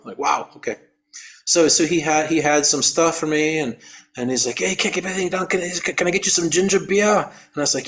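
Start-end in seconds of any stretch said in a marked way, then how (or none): 4.6-7.2 high-pitched Australian helper offering a drink; put-on Aussie lilt, raised pitch; Australian accent. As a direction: friendly offer in imitated Australian. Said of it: "You're Australian?".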